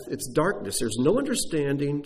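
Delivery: usual speaking voice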